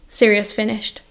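ordinary delivery, nothing marked

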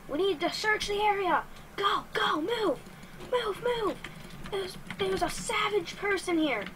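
high, urgent mock commands; rally the team in quick high bursts; urgent squad leader. Player ordering a search of the area, mock-urgent.

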